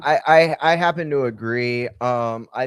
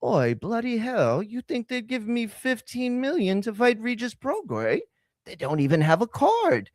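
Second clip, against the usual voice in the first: mock British accent; 7 semitones higher than usual. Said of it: "And then Ben's like".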